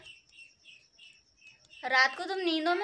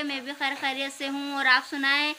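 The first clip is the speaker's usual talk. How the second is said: ordinary talk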